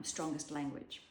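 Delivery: ordinary talk, nothing marked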